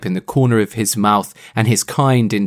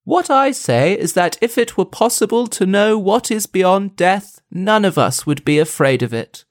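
lightly acted pleasant voice, starting higher and settling; pleasant familiar voice; 5 semitones higher than usual. Host quotes the voice from the shed, lightly acted pleasant voice. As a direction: read it as an agreeable philosophiser. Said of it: "A pleasant and familiar voice".

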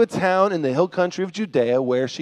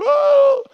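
very high, drawn-out whoop; hammed-up high holler; mock yelled "oh"; 4 dB louder than usual. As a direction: let out a play-scare cry. Grumpy old guy game, kids climbing.

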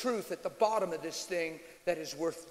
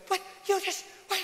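high-pitched, stunned sputter; freaking out; 12 semitones higher than usual. Retold or imitated. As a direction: imitate a startled onlooker stammering in disbelief. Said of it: "they're freaking out".

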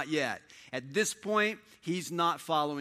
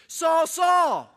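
loud, much higher call of Saul's name; Jesus calling out; 14 semitones higher, 12 dB louder than usual. Acted. Voice of Jesus calling Saul, loud and high. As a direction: shout the name down from heaven. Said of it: "and the voice says".

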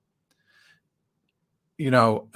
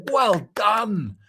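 mock applause; 9 semitones higher, slower than usual. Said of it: "wanted to applaud and say".

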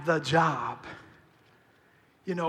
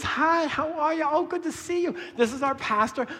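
lady's high greeting; 8 semitones higher than usual. Retold or imitated. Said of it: "this older lady says".

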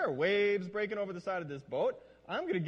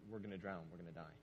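low, softer and softer until almost gone; fading to a hush; 9 semitones lower, 10 dB quieter than usual. Shown as a demonstration. Disciple quietly giving up in the boat, fading hush. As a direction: let the fear sink into a hush. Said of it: "that we can say".

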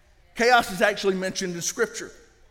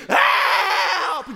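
imitated high, drawn-out scream for help; top-of-lungs scream; 10 semitones higher, 5 dB louder than usual. Storyteller mimicking friend clinging to a tree, screaming at full lungs. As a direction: scream it like a mock drowning man. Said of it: "Just screaming at the top of his lungs".

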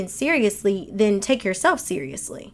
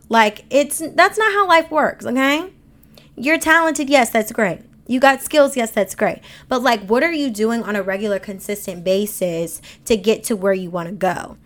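ordinary talk

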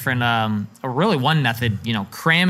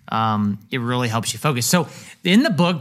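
usual speaking voice

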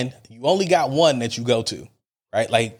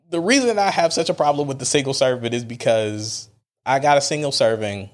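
ordinary delivery

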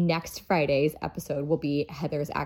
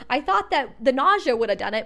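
ordinary talk, nothing marked

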